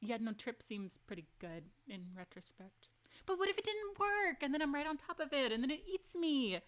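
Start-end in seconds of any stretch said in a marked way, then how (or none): 3.2-6.6 high, rising panicky what-ifs; fretting aloud at the choice; anxious worry. Real, not acted. Streamer fears the werewolf will eat her, voice high.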